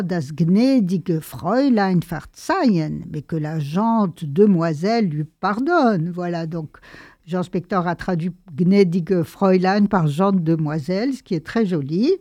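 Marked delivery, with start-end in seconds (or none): none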